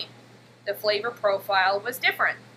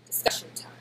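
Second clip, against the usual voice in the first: whispering; 6 dB quieter than usual. Real, not acted. Whispered aside.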